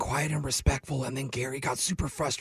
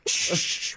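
shushing; 3 dB louder than usual. Acted. Mocking library shush.

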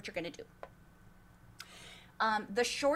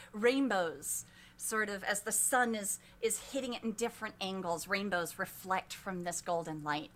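usual speaking voice

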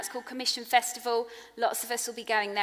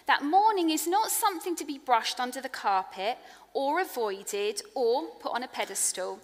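ordinary talk, nothing marked

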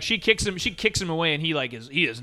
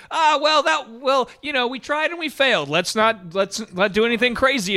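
mock porn store guy; 7 semitones higher than usual. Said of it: "porn store guy is kinda like".

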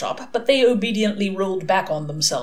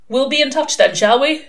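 higher, louder call; calling after; 6 semitones higher, 6 dB louder than usual. A narrator's reading. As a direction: call after someone leaving. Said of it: "Crowley called after him".

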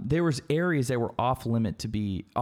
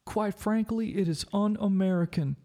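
high, mocking whiny politician voice; a sneering Schumer parody; mocking Chuck Schumer; 7 semitones higher than usual. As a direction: send up his pompous line, pitched up. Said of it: "Chuck Schumer".

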